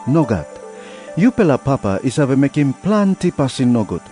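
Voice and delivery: usual speaking voice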